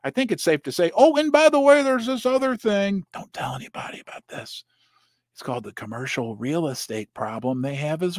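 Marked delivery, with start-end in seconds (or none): 1.0-3.0 high, loud, breezy aside, as if slipping in bad news; mock casual confession. Host mimicking banks owning up to hidden losses, mock breezy. Mocking the banks' late admission. 3.1-4.6 hushed conspiratorial whisper; whispered secret. Acted. Host sharing the banks' secret with viewers, whispering.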